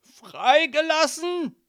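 loud, high, drawn-out shocked echo; alarmed disbelief. Acted. Father hears deadly animals are loose, loud alarm. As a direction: cry it out slowly in horror.

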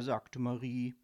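usual speaking voice

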